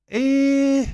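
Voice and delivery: very high, loud, long 'eh'; non-committal noise. Acted. Host unsure about a wrestling event, high non-committal noise. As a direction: squeal out a long doubtful 'eh'.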